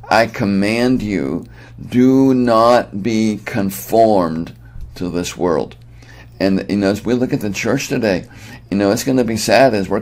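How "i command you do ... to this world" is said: slow, weighty imperative, DO NOT BE CONFORMED drawn out; emphatic command. Acted. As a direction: stretch out DO NOT BE CONFORMED as a firm order.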